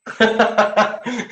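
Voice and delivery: a high, real burst of laughter. Real, not acted. Thanking Brad for his joke, a real high laugh.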